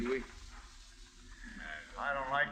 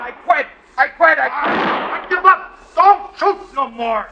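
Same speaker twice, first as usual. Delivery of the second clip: much higher, much louder panicked yelling; terrified surrender; 14 semitones higher, 16 dB louder than usual. Acted. Outlaw surrendering to the marshal under fire, panicked yell. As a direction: scream that you give up.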